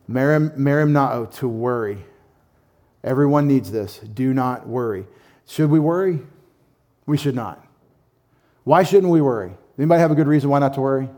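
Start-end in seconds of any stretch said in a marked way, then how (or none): none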